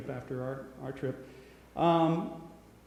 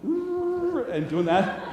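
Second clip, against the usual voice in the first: mock elephant trumpet; 8 dB louder, much slower than usual.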